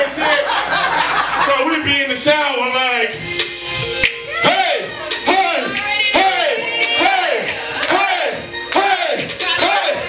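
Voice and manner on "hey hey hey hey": normal talking voice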